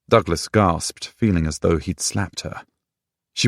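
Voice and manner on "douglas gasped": ordinary delivery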